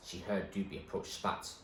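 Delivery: usual speaking voice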